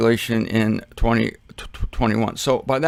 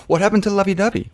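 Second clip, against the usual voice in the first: mock puzzlement; 7 semitones higher, faster than usual. Mock spouse asking after anger.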